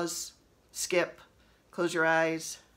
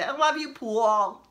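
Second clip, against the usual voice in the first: higher, louder swooning fan call; mock fangirl cry; 4 semitones higher, 6 dB louder than usual. Joking to her husband, mock fan shout. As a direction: tease like a starstruck teen. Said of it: "I still make that joke to my husband".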